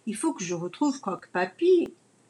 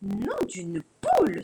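flaring up; 6 semitones higher than usual. Acted. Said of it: "s'emporte Carmen".

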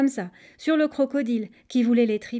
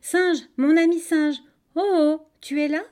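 raised, sing-out call; 7 semitones higher, 7 dB louder than usual. A narrator's reading. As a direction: hail a friend from a distance. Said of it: "Alors il a crié".

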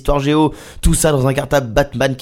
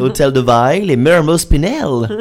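French station names in a put-on English accent; exaggerated English pronunciation; mock English accent. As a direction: anglicise each name like a tourist. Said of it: "il fallait savoir parler anglais".

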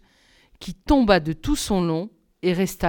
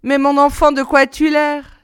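put-on higher, louder old woman's exclamation; mock-concerned old crone crying out; old woman exclaiming; 6 semitones higher, 7 dB louder than usual. A narrator's reading. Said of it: "s'exclama la vieille".

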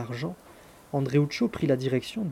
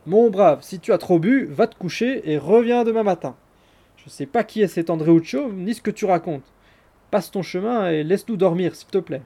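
higher, louder, brushing him off; scolding maid; 4 semitones higher, 7 dB louder than usual. A narrator's reading. Maid at the window dismissing the supposed drunk, scolding. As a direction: shoo a drunk away.

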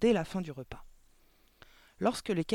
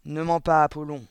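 loud, stern rebuke; forceful scolding, hit hard at the start; barked; 9 dB louder than usual. A narrator's reading. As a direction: bark a warning at the liar. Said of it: "aboya mademoiselle Le Gourdin".

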